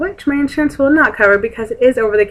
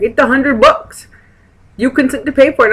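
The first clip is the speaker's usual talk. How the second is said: higher, louder imitation of a Russian pharmacist; Russian accent; 6 semitones higher, 4 dB louder than usual. Pharmacist telling the patient about the price, imitated Russian accent. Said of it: "and she's Russian".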